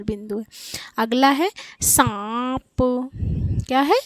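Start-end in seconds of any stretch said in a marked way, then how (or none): 1.8-3.1 सांप with long nasal आ, released प; nasalised vowel demo. Shown as a demonstration. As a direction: stretch सांप through mouth and nose. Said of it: "मुंह और नाक दोनों पे बल जा रहा है".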